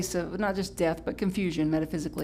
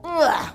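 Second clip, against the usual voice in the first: loud, higher-pitched ugh; short acted noise of discomfort; disgusted ugh; 9 semitones higher, 7 dB louder than usual. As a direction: groan it out in mock disgust. Disgust at uncomfortable catalysts.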